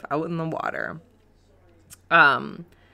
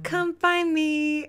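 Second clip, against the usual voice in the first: very high, slow singsong; imitating commercial's Courtney; 12 semitones higher, much slower than usual. Host quoting girl from dating ad, slow high coo. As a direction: draw it out in a cutesy girl voice. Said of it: "then she'll be like".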